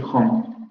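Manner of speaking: home with the h sound; standard home. Shown as a demonstration. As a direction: say home with a breathy h. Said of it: "en lugar de decir". (home con h aspirada)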